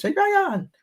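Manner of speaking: high-pitched fake Cuban accent; imitating a Cuban man calling downstairs; bad Cuban accent. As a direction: call out high in a badly faked Cuban accent. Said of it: "I can't even do a Spanish voice, Cuban voice".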